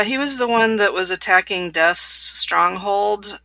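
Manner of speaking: ordinary talk, nothing marked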